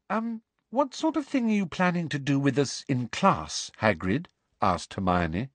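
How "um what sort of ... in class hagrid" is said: high-pitched hesitant question; tentative, higher girl's voice; cautious schoolgirl. Acted. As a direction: ask him carefully. Voices Hermione.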